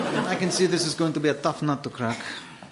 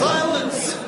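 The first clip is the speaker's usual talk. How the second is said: sudden high shout to shut everyone up; shouted silence; 9 semitones higher than usual. Acted. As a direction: bark the order to silence the room. Villain silencing the room.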